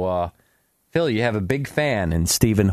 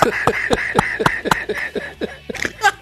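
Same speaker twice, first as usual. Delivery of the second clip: high puppet giggle; 3 dB quieter than usual. Acted. Falsetto laugh in puppet voice.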